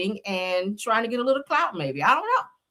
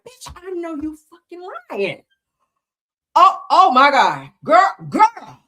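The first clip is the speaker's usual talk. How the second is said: high, slow, scandalized gasp of disbelief; dramatic disbelief; 10 semitones higher, 8 dB louder, slower than usual. Shown as a demonstration. Showing viewers her dramatic reaction to juicy messages, slow and high. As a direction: clutch your pearls over the juiciest text. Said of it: "Y'all do that when you be reading something real juicy".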